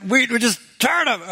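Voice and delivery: high, exasperated mock complaint; griping. Preacher voicing Israelites sick of eating manna, exasperated. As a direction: imitate fed-up people griping. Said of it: "they're just griping and complaining".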